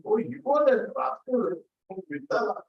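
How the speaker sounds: high, lightly mocking rascal call; delighted scolding. Wife realizing the boy is God, high playful scolding. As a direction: tease him as a cheeky rascal.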